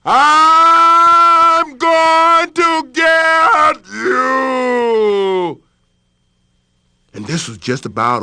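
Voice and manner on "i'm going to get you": very slow, ringing echo of a yell; the well echoing the threat back. Acted. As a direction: let it boom back from the depths. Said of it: "it yelled directly back".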